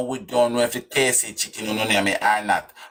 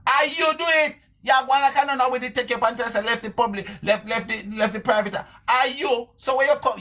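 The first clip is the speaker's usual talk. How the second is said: incredulous taunts in a very high pitch; mock scolding; 13 semitones higher than usual. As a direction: needle her with high, derisive questions. Taunting the absent woman.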